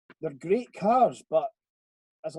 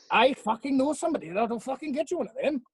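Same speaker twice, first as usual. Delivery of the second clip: higher, put-on Welsh accent; mock Welsh accent; 8 semitones higher than usual. Max offering to find the car, mock Welsh accent. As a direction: do him as a sing-song Welshman. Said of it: "so I'll do a Welsh one instead".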